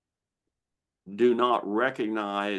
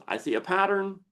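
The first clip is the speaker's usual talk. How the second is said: noticing it, pitched higher; lizard brain voice; 8 semitones higher than usual. Retold or imitated. Lizard brain explaining itself to the listener, lightly voiced. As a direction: voice the brain spotting a pattern. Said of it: "it goes".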